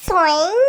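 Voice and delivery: long nasal glide that swoops upward; playful "soing" whoosh. Acted. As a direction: stretch the sound into a rising slide. Imitating a whooshing ride, playful. (விளையாட்டாக இழுக்கும் சறுக்கு ஒலி)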